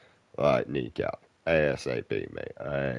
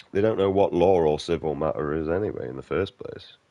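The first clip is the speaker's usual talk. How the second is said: normal talking voice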